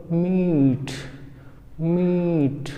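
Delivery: मीट with long ee and aspirated ठ; teacher demo of मीट. Shown as a demonstration. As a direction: stretch ee and end मीट on a breathy ठ. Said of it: "क्या बोलेंगे इसको?".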